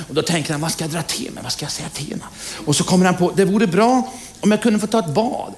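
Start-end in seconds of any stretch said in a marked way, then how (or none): 0.6-2.2 soft, muttered thinking aloud; a hushed, pondering groom; inner thought. Acted. 3.4-5.5 higher, hopeful groom; lightly voiced eager groom; groom's wish. Groom makes his wish.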